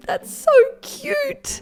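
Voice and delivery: very high, stretched-out cooing squeal; squeaky gushing. Real, not acted. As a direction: squeal slowly over something adorable. Gushing over dog spas.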